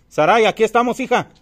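loud high call into the dark. Real, not acted. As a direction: shout her name from afar. Calling out to the friend.

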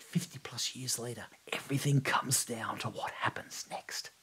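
hushed, breathy build-up of suspense; tense whisper. A narrator's reading. Narrator hushes to hold the tension, whispering. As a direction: draw the listener in with a whisper.

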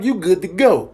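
normal talking voice

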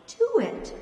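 sharp, high, loud command; barked order. A narrator's reading. Pat orders the old clerk, barking. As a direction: snap the order at him.

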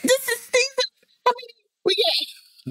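high, soft, tearful words; choking up over the show; crying. Real, not acted. Viewer tearing up at a character's death, crying. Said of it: "Are you crying?".

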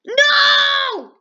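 high, loud drawn-out no; shout of dismay. Real, not acted. Gamer reacting to a loss, real loud dismayed shout. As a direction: yell it as everything goes wrong.

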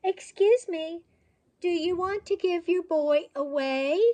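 high, loud, courteous hail; ringing high-pitched polite request; loud polite call. A narrator's reading. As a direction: call out loudly but very politely. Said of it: "called out loudly, but very politely".

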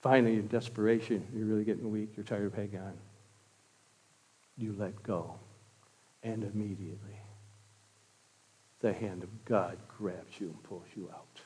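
low, very soft sermon voice; hushed preaching. Real, not acted. Preacher describes the weary letting go into God's hand, hushed. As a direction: bring it right down to a hush.

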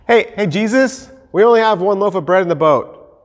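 high-pitched, casual call to Jesus; imagined disciple. Pretend.

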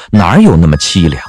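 ordinary delivery

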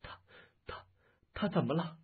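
faltering, broken-up anxious question; trembling voice. Acted. Wife fearing for her husband, trembling voice. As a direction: stammer it out in dread. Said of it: "颤声道".

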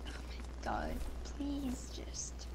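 soft, anxious whisper. Real, not acted. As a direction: whisper a nervous plea. Nervous pleading under breath.

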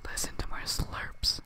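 soft hushed ASMR whisper. Acted. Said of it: "I'm doing the A S M R session".

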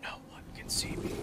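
hushed playful whisper. Pretend. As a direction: breathe it like you're hiding. Invisible in game, sneaking.

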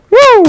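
loud, high "woo" of excitement; high whoop. Real, not acted. Cheer at round's end.

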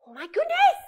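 usual speaking voice

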